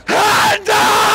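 high, loud call to the crowd; hype shout. Real, not acted. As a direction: shout to get hands up. Hyping the crowd before singing.

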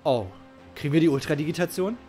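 ordinary talk, nothing marked

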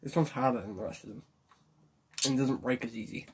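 talking while chewing; chewing hard candy. Real, not acted. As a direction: mouth partly full. Seen 1: Puts candy in mouth at -4; jaw working, strained face throughout.

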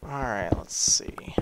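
slow whispered aside. Real, not acted. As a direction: whisper to yourself. Muttering to self.

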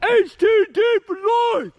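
high, slow, loud holler; yelled slogan. Pretend. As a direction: holler it out for the echo. Yelling out for the echo.

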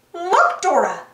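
shouting. A narrator's reading. Monkey shows Dora the lakes, mild shout. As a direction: get your friend's attention. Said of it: "shouted Boots".

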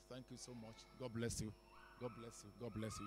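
ordinary talk

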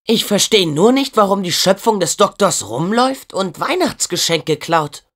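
ordinary delivery

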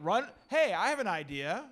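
ordinary delivery

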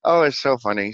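normal talking voice

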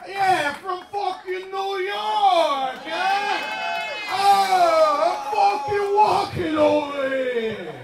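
high, loud, drawn-out New York yell; brash New Yorker shout. Acted. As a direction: holler like a hammed-up New Yorker. Comic acts brash New Yorker.

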